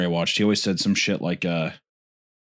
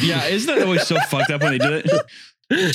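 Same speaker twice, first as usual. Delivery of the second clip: ordinary talk, nothing marked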